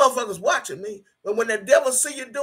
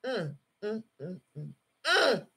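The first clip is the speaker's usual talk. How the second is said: choked grieving hums; 8 dB quieter than usual. Real, not acted. Grieving, near tears.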